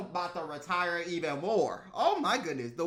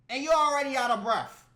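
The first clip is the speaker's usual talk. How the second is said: ordinary delivery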